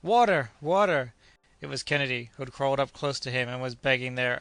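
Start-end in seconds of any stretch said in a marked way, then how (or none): 0.0-1.1 high, loud desperate cry for water; heart-rending cry. A narrator's reading. Kennedy begging Joe for the water, heart-rending cry. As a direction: beg with a piercing cry. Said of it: "a heart-rending cry".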